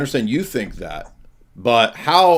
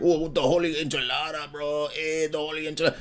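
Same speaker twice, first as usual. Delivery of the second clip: higher, softer mock Mexican accent; imitated caller; 5 semitones higher, 5 dB quieter than usual. Host imitating how the caller came in, mock Mexican accent. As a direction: parody him in a put-on Mexican accent. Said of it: "Why you come in here?".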